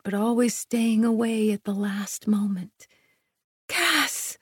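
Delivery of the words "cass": high, breathy cry of his name; panting, pitched-up exclamation; panted. A narrator's reading. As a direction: gasp the name out high, in pleasure. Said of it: "I panted".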